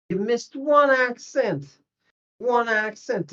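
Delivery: "one" pitched high and stressed. Shown as a demonstration. Teacher pointing out the one missed accent, stressed. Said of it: "putting the extra stress on that".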